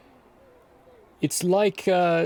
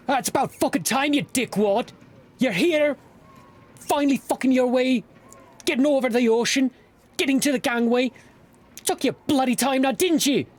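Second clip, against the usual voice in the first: high, fast, cursing dwarf rant; angry old dwarf; 12 semitones higher, 3 dB louder, faster than usual. Acted. Dwarf scolding the late arrivals, high fast angry rant. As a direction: bark at them like a grumpy old dwarf.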